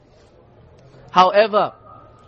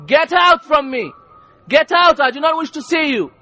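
put-on stern mother ordering her child out, raised pitch; angry scolding mother; 3 semitones higher than usual. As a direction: send the child away in anger. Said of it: "tells the child".

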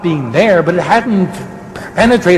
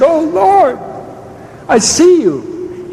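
exclaiming in wonder; 11 semitones higher, much slower than usual. Acted. Voices Mary seeing risen Lord.

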